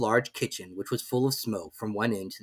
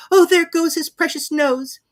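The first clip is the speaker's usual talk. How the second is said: put-on high, loud, frightened girl; terrified cry; 9 dB louder than usual. A narrator's reading. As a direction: plead in panic. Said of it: "in agony of terror".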